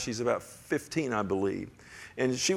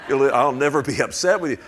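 a breathless laugh into a louder line; 8 dB louder than usual. Real, not acted. Preacher retelling his reassurance to Elma, laughing. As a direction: laugh then declare it.

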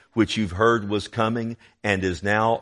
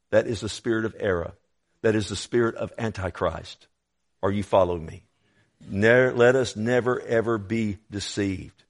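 ordinary talk

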